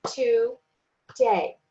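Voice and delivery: "today" split into two separate beats, to and day; clapped-out syllables. Shown as a demonstration. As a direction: say "today" with a clear gap after the first syllable. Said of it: "if I clap for today".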